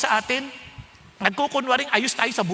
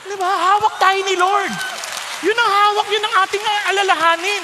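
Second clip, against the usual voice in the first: forceful preaching; 7 semitones higher, 7 dB louder than usual. Acted.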